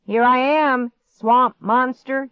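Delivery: high, loud singsong-slow call; taunting call. A narrator's reading. Boy mocks the monster at the door, calling out. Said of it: "he called out".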